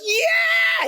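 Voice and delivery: very high excited yelp; Kenny jumping up and down, yelling yeah; eager yell. Retold or imitated. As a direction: leap at it like a kid. Kenny's eager reply acted.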